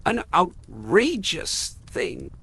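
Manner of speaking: slow, high, hammering repeat; slow violent repeat. A narrator's reading. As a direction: drag out the outrage slowly. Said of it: "said Uncle Benjamin violently".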